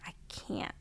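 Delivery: barely voiced, a tearful whisper; broken whisper. Telling husband at lunch she can't go on, whispered. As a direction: let the words almost vanish on your breath.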